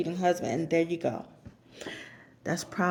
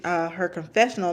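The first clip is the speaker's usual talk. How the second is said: usual speaking voice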